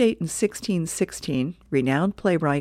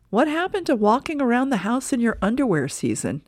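put-on higher, teasing little girl voice; kid sister; 7 semitones higher than usual. [name] teasing her brother on the couch, higher kid voice. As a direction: tease your big brother.

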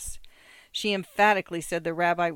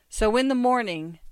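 raised, louder, hurried instruction; gasped; 5 semitones higher, 6 dB louder than usual. A narrator's reading. Father instructing son as they run, gasping lightly. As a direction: push the words out while running. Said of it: "Mendel gasped as they ran".

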